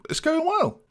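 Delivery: very high-pitched, surprised squeak; shocked. Real, not acted. Gamer amazed his team is finally winning, shocked. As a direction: let your voice leap up in disbelief. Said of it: "a little bit of shock in my voice".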